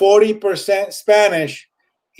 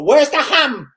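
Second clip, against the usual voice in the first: a higher, exaggerated voice asking plainly in English; imitating someone asking for ham in English; mock English shopper; 8 semitones higher than usual. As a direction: play the same shopper switching to English, pitched up. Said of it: "No, they go".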